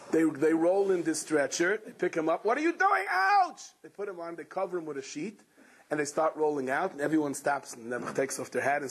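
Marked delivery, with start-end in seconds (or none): 2.4-3.6 high alarmed cry of pain; pained yelp. Acted. Patient lifted onto the stretcher, high pained yelp. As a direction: yelp as they grab you.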